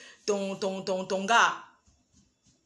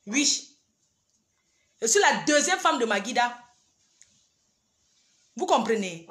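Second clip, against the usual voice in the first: ordinary delivery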